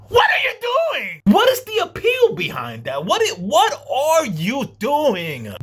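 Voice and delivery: high exasperated what are you doing; exasperated disbelief. Real, not acted. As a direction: throw the question out in disbelief. Exasperated reaction to clip.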